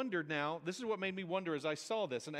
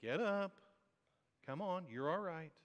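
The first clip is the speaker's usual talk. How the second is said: comforting. Shown as a demonstration. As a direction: reassure someone who has fallen down. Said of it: "Is it comforting?".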